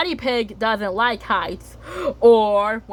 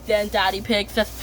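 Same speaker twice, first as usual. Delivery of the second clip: normal talking voice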